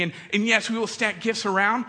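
ordinary talk

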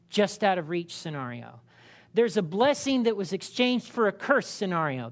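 ordinary talk

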